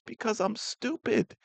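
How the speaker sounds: mock tearful high voice, stress on stupid; imitating Penny. Vlogger voicing a crying Penny, high mock-tearful. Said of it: "And she says".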